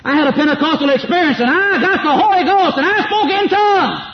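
high-pitched, hollering parody of a believer; mocking boastful testimony. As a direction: crow it like an overexcited convert. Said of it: "People talk about having a Pentecostal experience".

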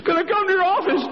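high woman's voice asking; high timid request; dentist's wife. Acted.

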